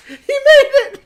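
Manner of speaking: high, loud words through laughter; laughing shriek. Real, not acted. Streamer laughs and squeals that the player arrived.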